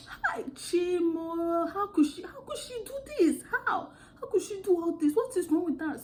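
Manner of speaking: put-on high, dramatic African accent lament; African accent imitation. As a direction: wail it in a mock African accent, half laughing. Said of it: "I'm still speaking like that".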